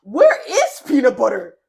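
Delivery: high, loud lilting question; animated questioning. Retold or imitated. Storyteller replaying her own question about Peanut Butter, animated questioning. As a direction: ask it with big swoops up and down in pitch.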